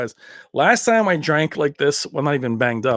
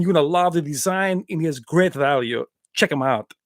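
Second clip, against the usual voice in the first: Van Damme impression. Reviewing a TV for Gary as a joke, Van Damme impression. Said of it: "do my movie reviews or my T V reviews like that".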